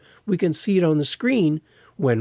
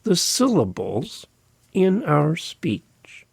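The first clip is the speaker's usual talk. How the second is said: exaggerated syllables; much slower than usual. Shown as a demonstration. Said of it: "we seem to exaggerate".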